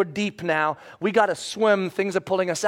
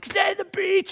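high-pitched mock shout, held back in volume; strained high call-out; retold shout; 13 semitones higher, slower than usual. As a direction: imitate yelling to someone down the shore. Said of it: "and shouting".

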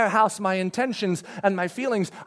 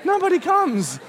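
normal talking voice

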